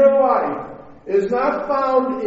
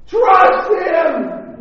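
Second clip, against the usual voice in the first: high, loud yell at the screen; screaming at the TV; 11 semitones higher, 8 dB louder than usual. Acted. Moviegoers yelling advice at the TV, screaming. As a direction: holler it like a frantic movie viewer. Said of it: "we'd be screaming at the T V".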